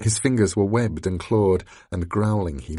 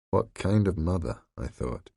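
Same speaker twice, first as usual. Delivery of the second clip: ordinary delivery, nothing marked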